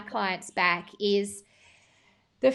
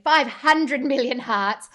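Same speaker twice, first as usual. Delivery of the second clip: louder and slow, stretched out for comic overstatement; gushing exaggeration; 3 semitones higher, 9 dB louder, slower than usual. Real, not acted. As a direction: ham up the huge number, drawn out. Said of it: "me exaggerating again".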